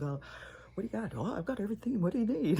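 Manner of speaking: high-pitched mock helpfulness; playful eager offer. Woman unloading her suitcase-sized purse, playfully eager. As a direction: pipe up high, as if offering anything from a bottomless bag. Acts out rummaging through huge bag.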